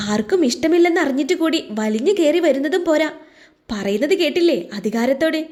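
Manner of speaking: ordinary delivery, nothing marked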